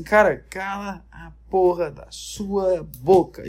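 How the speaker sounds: fed up. Retold or imitated. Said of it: "todo mundo falava assim".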